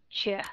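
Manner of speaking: "Sure" said lightly, reduced to a schwa; light reduced syllable. Shown as a demonstration. Teacher showing the weak ending of rupture, said lightly. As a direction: let "Sure" drop soft and unstressed. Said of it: "đọc nhẹ đi".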